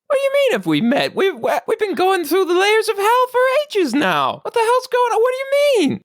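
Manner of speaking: high, rushed, baffled pig voice; indignant little pig. Acted. Pig insists they have travelled together, indignant high voice. Pig bewildered at being unknown.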